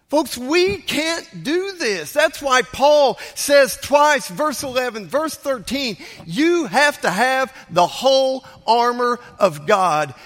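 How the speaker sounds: high, loud, slow sermon climax; impassioned, drawn-out pulpit cry; full-force preaching. Real, not acted. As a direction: stretch each word out and proclaim it to the congregation.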